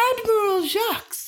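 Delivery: high, bright, exaggerated welcome; mock cheerful greeting. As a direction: greet him like an old friend, with menace. Otto greets his enemy.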